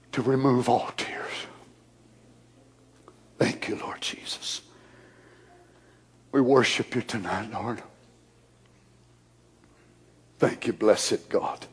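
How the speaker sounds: soft, low, breathy prayer; whispered worship. Acted. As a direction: hush into worship.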